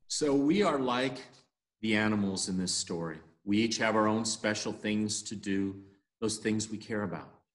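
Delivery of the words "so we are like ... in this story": plain reading voice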